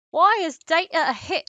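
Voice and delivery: mock British accent, quoting a Brit; a hammed-up British accent. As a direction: say it like a bemused Brit. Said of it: "he said it in a British accent".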